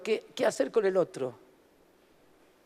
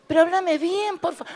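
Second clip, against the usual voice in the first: exasperated plea; 7 semitones higher than usual. Retold or imitated. Voicing an exasperated listener.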